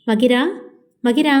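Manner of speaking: ordinary delivery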